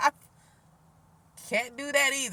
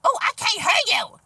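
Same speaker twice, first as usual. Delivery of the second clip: high, loud mocking caller; aggressive, loud mimicry; 10 semitones higher, 7 dB louder than usual. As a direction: imitate a pushy caller yelling down the line. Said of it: "they be talking so aggressive and loud".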